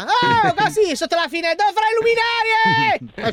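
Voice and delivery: high, slow shout upward; yelling under a window. Pretend. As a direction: call up to a window. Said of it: "Vuole che venga a urlare sotto la finestra". (urlo sotto la finestra)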